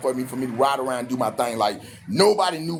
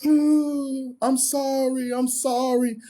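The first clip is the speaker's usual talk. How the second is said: put-on girlfriend crying; 10 semitones higher, much slower than usual. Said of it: "She break down and start crying".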